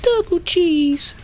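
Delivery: high-pitched slow Jim Cornette impression; falsetto. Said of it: "his really high-pitched impression of Jim Cornette".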